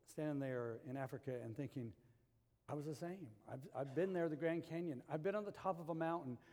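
ordinary talk, nothing marked

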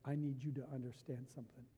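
a mild, hushed mad father; low and soft, the anger held in. Retold or imitated. Father to rebellious teen daughter, retold hushed anger. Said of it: "And, oh, I was mad".

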